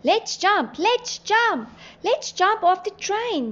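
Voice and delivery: high, loud repeated call; high-pitched insistent exclamation; urgent urging. A narrator's reading. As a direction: urge everyone to act at once. Urging a panicked jump from train.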